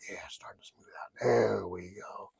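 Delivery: relieved whisper. Pretend. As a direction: breathe it out in a whisper. Turbulence easing, relieved hush.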